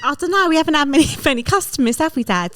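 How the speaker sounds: worried daughter. Acted. Daughter role in the act-out.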